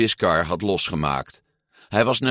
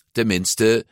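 ordinary delivery

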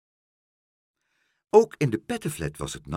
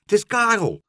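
high, loud, happy exclamation; glad; 12 semitones higher, 9 dB louder than usual. A narrator's reading. Meneer Pen recognises Karel overhead, glad. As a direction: cry out, delighted to spot a friend. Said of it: "zei meneer Pen blij".

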